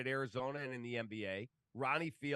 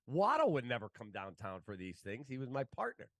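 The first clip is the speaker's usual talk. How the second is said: normal talking voice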